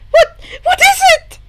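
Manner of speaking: high, loud startled question; alarmed girl. Acted. Hagakure hearing Shoto's groan, high startled alarm.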